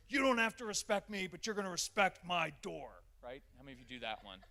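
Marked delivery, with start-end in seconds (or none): 0.1-3.0 loud, high angry parent yell; furious yelling. Retold or imitated. Furious father yelling at his son over a slammed door. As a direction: yell at the kid like a furious dad. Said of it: "I get furious".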